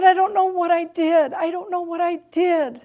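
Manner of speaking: high, loud, trembling plea on the verge of tears; a hurt teen choking back tears; imitating a distraught nineteen-year-old; tearful upset daughter. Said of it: "her lip is quivering and her eyes are filled with tears".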